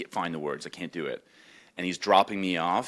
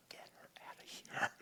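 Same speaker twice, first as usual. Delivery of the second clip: fast hushed whisper; urgent whisper; 17 dB quieter, much faster than usual. Retold or imitated. Hiding in the bedroom, begging sister to get mom out, fast whisper. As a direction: hiss it quickly under your breath. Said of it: "I'm being like".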